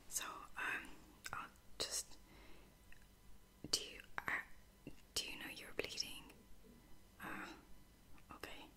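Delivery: gentle, hushed bedside whisper; whispered role-play. Pretend. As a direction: whisper like a caring medic checking on a patient. ASMR role-play as caring lieutenant.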